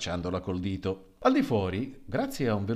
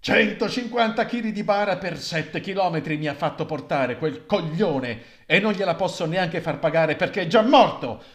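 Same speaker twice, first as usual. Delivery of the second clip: exasperated exclamation; 6 semitones higher, 7 dB louder than usual. Acted. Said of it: "esclamò uno dei sei portatori".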